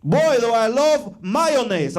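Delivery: loud, slow mock shout; hammed-up slow yell; shouted password. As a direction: bellow it across the store.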